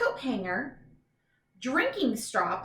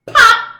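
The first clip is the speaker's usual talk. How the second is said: sudden, much higher, loud popping sound; loud pop sound; 12 semitones higher, 18 dB louder than usual. Acted. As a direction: make the bubble burst out loud. Reading bubble bursting aloud.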